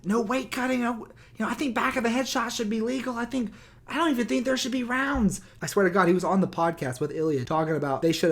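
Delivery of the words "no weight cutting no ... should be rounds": mock Joe Rogan. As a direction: parody him squeaky and smug. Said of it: "he's like".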